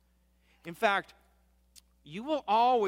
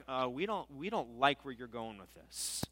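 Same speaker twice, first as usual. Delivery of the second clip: low, quiet imitation of disapproving Pharisees; sneering Pharisees; 6 semitones lower, 7 dB quieter than usual. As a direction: object under your breath, lightly mocking. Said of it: "they sneered at him".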